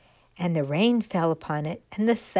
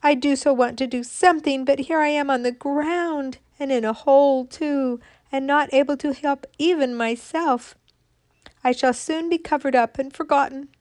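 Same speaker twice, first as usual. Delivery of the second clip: high, louder, hurried lament; frightened despair; 7 semitones higher, 5 dB louder than usual. Acted. Fallen acorn lamenting in fright on the ground. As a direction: wail about being lost and useless. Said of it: "said the frightened little thing".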